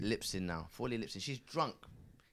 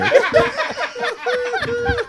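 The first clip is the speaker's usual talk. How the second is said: louder, laughing mock questioning; mock wife, laughing; 16 dB louder than usual. Mocking a teacher's wife over the payslip, laughing. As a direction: play the wife grilling him while cracking up.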